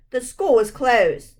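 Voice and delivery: loud, slow, menacing growl. A narrator's reading. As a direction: growl it like a grumpy guard. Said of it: "the janitor growled".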